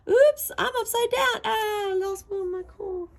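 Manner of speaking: high, loud cartoon squeal; squealing Rapunzel. Upside-down Rapunzel losing her coin, loud high squeal. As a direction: yelp it like a flustered princess.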